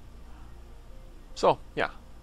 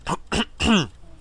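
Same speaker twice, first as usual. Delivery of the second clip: throat-clearing cough; real cough; 7 semitones higher than usual. Real, not acted. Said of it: "I'm gonna cough in a second".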